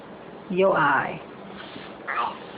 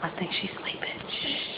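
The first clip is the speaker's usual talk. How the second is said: whisper; 3 semitones lower, 13 dB quieter, faster than usual. Real, not acted. Baby drifting off, then shh.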